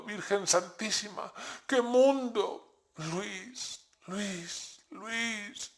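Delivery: high, soft tearful keening; grieving mother's broken lament; weeping lament. A narrator's reading. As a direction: whimper the names through tears.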